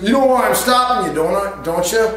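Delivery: a high, loud and fast mock cop voice; lightly voiced stern traffic cop, pushing hard. Cop questioning the driver.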